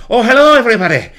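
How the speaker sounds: loud, high, fast Scottish greeting; Scottish accent. Retold or imitated. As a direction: burst in with a big Scottish hello. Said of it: "Scottish as I can Scottish".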